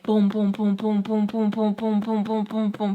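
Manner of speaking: rapid-fire string of even booms; imitated pounding. Finding him gone and freaking out, pounding booms. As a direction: pound out a steady run of booms.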